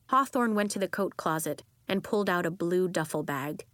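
ordinary delivery, nothing marked

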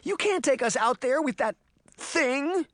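high, panicked protest; frightened high-pitched objection; crying out. A narrator's reading. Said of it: "Sabrina cried".

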